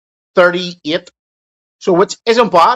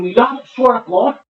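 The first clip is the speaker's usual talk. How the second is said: ordinary talk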